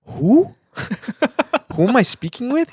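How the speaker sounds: very high, loud "who's this?"; retold bafflement, pitch leaping up; incredulous questioning. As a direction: squeak out a baffled question, hammed up. Retold wary reply to unknown caller.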